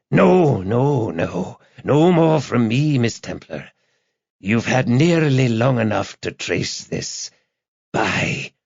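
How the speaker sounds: high, slow, gloating caller; taunting madman. A narrator's reading. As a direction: cut her off in a crazed voice. Madman cuts off phone call.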